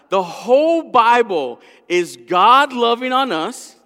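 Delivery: loud, high, slow proclamation; full-force preaching. Real, not acted. Preacher tells congregation of God's love, loud impassioned preaching. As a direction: proclaim it loud, stretching the words.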